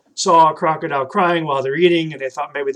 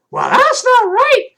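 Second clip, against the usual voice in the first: put-on high, loud kid puppet voice; bright high puppet calling a friend; 7 dB louder than usual. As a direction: call out like an eager little puppet. Puppet Troy objects.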